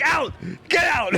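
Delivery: high yelled "Out! Get out!" as the killer; mock angry shout. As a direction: imitate the furious killer chasing them out. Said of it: "He's like".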